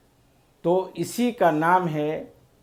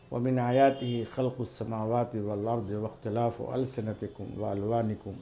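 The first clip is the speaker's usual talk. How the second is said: chanted Quran verse, quicker and lower than his talk; softer reverent Arabic recitation; Quranic recitation; 7 semitones lower, 8 dB quieter, faster than usual. Shown as a demonstration. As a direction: recite the verse with tajweed flow.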